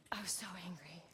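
hushed, voiceless whisper of anger; angry whisper. Real, not acted. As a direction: whisper it as if confessing your anger. Mom recalls anger, whispers it.

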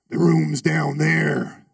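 low, very slow, menacing and loud; ominous biker. Retold or imitated. Biker pointing to the basement, low and slow. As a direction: drag it out like a horror villain. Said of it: "He talked like that too".

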